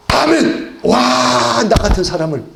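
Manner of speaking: jubilant high "아멘!" bursting out; eager amen. Pretend. Preacher voicing Barak's imagined eager reply, jubilant. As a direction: leap at the call with joy.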